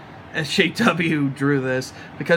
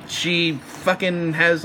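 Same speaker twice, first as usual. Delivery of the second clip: ordinary talk, nothing marked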